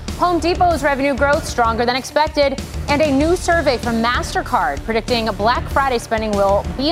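upbeat anchor headline read; news teaser read. Acted. Show teaser read over music.

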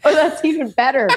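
amused, through a laugh; real chuckle in the voice. Real, not acted. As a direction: react while still laughing. Amused by the punchline.

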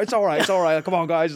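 mock-optimistic Australian encouragement; Australian accent. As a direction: reassure the team in a light Australian accent. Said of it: "most optimistic guy ever and yelling in his Australian accent".

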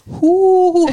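high, loud, exaggerated drawn-out hoot; mock Jennifer Aniston. Teasing co-host over the delivery, loud high hammed-up mimicry. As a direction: mimic the actress's delivery with a laugh. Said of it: "That was a Jennifer Aniston delivery".

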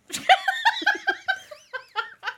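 high, loud burst of laughter; cracking up; shrieking laugh at the cringe; real laughter. Real, not acted. Laughing at her own story.

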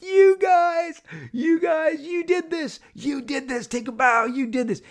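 high, elated cry of congratulation; excited shouting. Retold or imitated. To Rhett and Paul on stage, high excited shouting.

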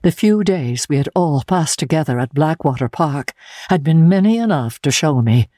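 plain reading voice

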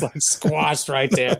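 ordinary talk, nothing marked

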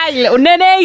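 high mock name-calling; hammed-up affectionate jeer; playful teasing. Teasing friend after laughing.

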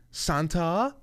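high, rising French-style "san-TAH?"; French accent. Retold or imitated. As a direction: imitate a little girl putting on French. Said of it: "with this French accent".

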